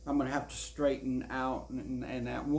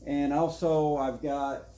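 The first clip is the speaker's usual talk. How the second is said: out of breath. Real, not acted. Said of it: "I'm out of breath here".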